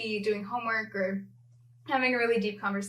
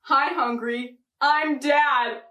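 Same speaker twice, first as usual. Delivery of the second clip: higher, louder cheesy dad voice; embarrassing dad joke; 3 semitones higher, 5 dB louder, slower than usual. Acted. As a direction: play the corny dad with pride. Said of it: "Classic dad joke!".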